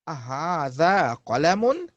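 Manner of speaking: أهذا قلم lifted at the end; rising question tune on قلم. Shown as a demonstration. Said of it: "You put a a in front of it".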